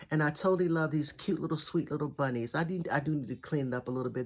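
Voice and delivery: normal talking voice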